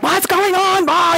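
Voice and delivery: high, excited mock freak-out; imitating a high-pitched excitable person; excitable friend. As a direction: play the jumpy friend panicking. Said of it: "and they're like".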